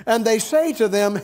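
ordinary delivery

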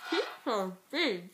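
slow, mushy mouthful of candy; cheeks stuffed full. Real, not acted. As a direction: talk round a stuffed mouth. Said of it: "Peeps are really hard to fit in your mouth".

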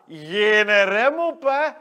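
slow and loud, stretch every word; loud, drawn-out boast. Acted. Jordi bragging about no brakes, slow and loud. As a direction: savour each word loudly.

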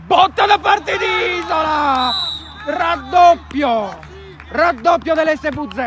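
slow, stretched loud goal call; goal shout. Real, not acted. Second goal called live. (telecronaca urlata del gol)

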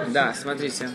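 ordinary talk, nothing marked

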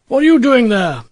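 loud challenging question; demanding. A narrator's reading. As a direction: call out to the stranger boy, demanding an answer. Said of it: "he demanded".

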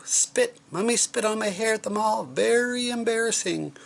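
put-on very high squealing kid voice; mortified yell. A narrator's reading. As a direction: wail it high in mock outrage. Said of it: "yelled Andrew".

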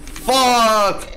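loud high curse of frustration; angry shout. Real, not acted. Gamer raging at a delayed hit, loud high curse. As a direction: spit out the curse as the hit fails.

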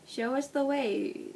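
slow, commanding tone; ordering. Acted. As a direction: give a firm order. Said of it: "he ordered".

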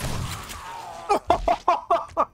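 long, high burst of laughter. Real, not acted. Laughs at the zombie fight.

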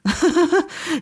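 a forced laugh with nothing behind it; hollow, joyless laugh. A narrator's reading. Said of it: "Draco lachte, obwohl der Klang hohl war".

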